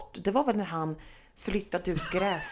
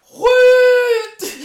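much higher, loud yelled Skjut; shouted command; 14 dB louder than usual. Retold or imitated. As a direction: yell it like a hunter in the woods. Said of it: "hör jag bara utifrån så här". (imiterat jägarskrik)

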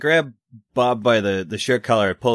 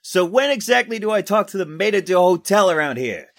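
high, raised joking call; calling out in character; 10 semitones higher than usual. Acted. Reporter joking to the soldier while crawling behind him, calling out. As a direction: shout a wry question over the din. Player calls to yelling soldier.

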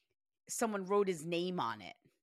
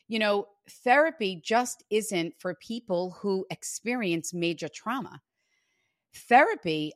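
ad read; 7 dB louder than usual. Acted. Host reads sponsor ad.

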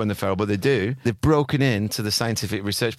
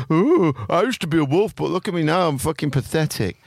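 dog voice; 5 semitones higher than usual. Pretend. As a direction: whine like a sorry pet dog. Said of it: "and they're like".

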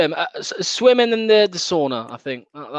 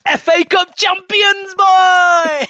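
high, loud, slow triumphant yell; celebratory shout; 11 semitones higher, 7 dB louder, much slower than usual. Real, not acted. Fan signs off by bellowing about the cup win. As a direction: roar it like the final whistle just went.

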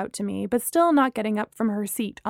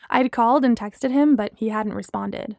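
ordinary delivery

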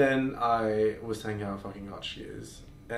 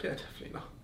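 whispering; 8 dB quieter, much faster than usual. Retold or imitated. Her reply retold.

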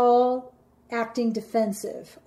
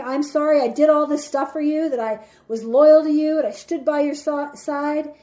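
louder, higher, indignant list of sorrys; angry sarcastic apology; 3 semitones higher, 5 dB louder than usual. Acted. Partner who was loyal and got cheated on, angrily sarcastic. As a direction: throw each sorry as an accusation. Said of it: "I'm angry that I found out".

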